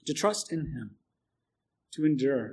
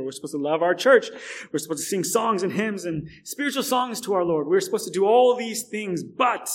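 louder, higher preaching climax; preaching at full force; 5 semitones higher, 6 dB louder than usual. Acted. Pastor stirring the congregation, louder and higher. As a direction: pile on the duties with rising force.

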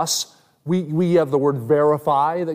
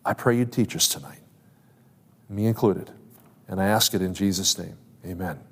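soft, lower closing prayer; quiet prayer; 7 semitones lower, 5 dB quieter than usual. Real, not acted. Pastor closing a prayer before teaching, softly. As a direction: pray it gently under the breath.

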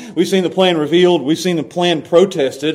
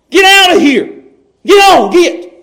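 higher, louder shout to chase off a dog; shooing a stray dog; 10 semitones higher, 7 dB louder than usual. Retold or imitated. Preacher shouting at a stray dog in his yard, loud shooing yell. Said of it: "Like a stray dog coming up".

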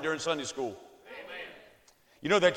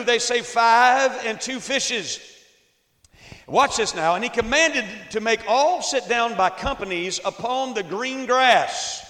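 loud, high, slow Bible reading; preaching scripture; 5 semitones higher, 7 dB louder than usual. Acted. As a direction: proclaim the verses to the church. Reads scripture aloud at force.

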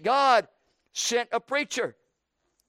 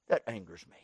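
grumbled aside; 13 semitones lower, 8 dB quieter, much faster than usual. Acted. Jonah's grumbling aside to God.

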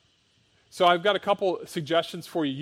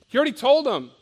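usual speaking voice

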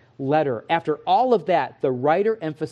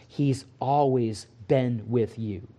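reassuring; 3 semitones lower, slower than usual. Real, not acted. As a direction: lay each word down gently. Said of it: "slow assurance after lies".

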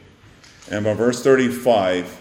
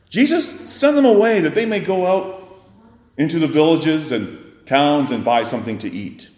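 higher, louder pleading push to send them off; impatient disciples; 6 semitones higher, 5 dB louder than usual. Retold or imitated. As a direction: hurry Jesus along, fed up. Impatient disciples urging Jesus.

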